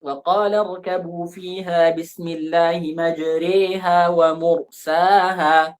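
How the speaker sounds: slow melodic tajwid recitation; Quran recitation. Shown as a demonstration. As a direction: recite it slowly, drawing out the vowels. (tilawah pelan dengan lagu)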